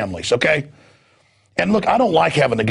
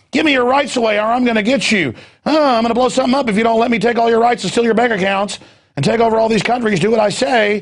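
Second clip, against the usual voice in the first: higher, quick hammed-up villain threats; mock terrorist puppet; 7 semitones higher than usual. Host mocking the Al-Qaeda sock puppet to listeners, hammed-up villain voice. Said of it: "they put a sock puppet, you know, of Al-Qaeda up and go".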